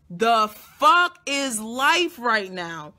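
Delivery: slow, loud exclamation of shock; loud retold disbelief. Reacting to the pregnancy news, loud slow disbelief. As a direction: draw it out loudly, in disbelief.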